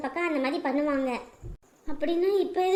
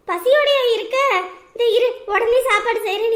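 eager excitement; 5 semitones higher, 7 dB louder than usual. Acted. Sister eager to feed hungry ghost.

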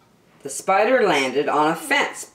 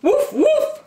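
very high, loud puppy woofs; high-pitched playful barking; dog's bark; 5 dB louder than usual. Acted. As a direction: bark like a little yappy dog. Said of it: "barked the dog".

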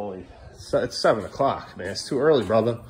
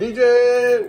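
raised, higher DJ shout-out; playful call-out; 6 semitones higher, 6 dB louder than usual. Pretend. Parent calls child by DJ nickname, playful shout. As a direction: call it out like a hype man.

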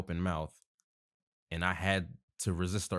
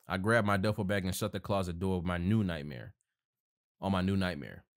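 plain reading voice

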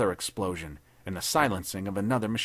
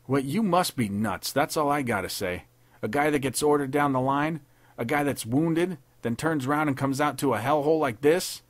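raised, louder tough-guy rant; scornful soldier; 5 semitones higher, 3 dB louder than usual. A narrator's reading. Wesson, to McBride back from being wounded, scornful. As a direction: scold him like he's crazy.

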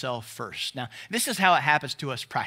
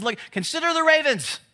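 much higher, eager pointing-out; distracted excitement; 9 semitones higher than usual. Preacher voicing Jesus noticing a bird swooping down, excited. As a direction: call attention to birds swooping past. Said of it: "Jesus has A D H D".

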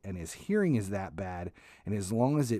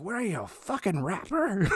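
much higher mock mom, scoffing; 10 semitones higher than usual. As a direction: scoff at him as mom, way up high. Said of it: "My mom's like".

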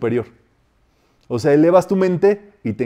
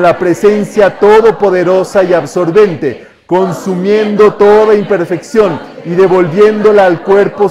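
loud, raised, ringing decree; decree recitation; 5 semitones higher, 9 dB louder than usual. Acted. Teacher reciting a healing decree for the class, loud declamation. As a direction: declaim it like a powerful invocation.

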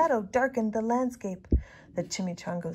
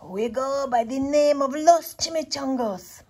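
loud, higher, mock gruff growl; a lightly voiced tough chihuahua boss; growled line; 5 semitones higher, 8 dB louder than usual. A narrator's reading. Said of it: "growled Don Diego".

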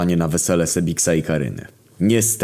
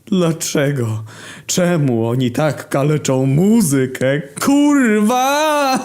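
crying lament; 11 semitones higher, 4 dB louder, slower than usual. Acted. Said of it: "Ferdek płacze".

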